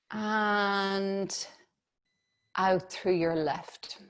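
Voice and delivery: calm, unhurried meditation voice; guided meditation. Acted. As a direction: guide the breathing gently. Leads breathing meditation.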